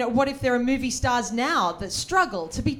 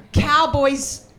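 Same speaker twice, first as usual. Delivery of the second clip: ordinary delivery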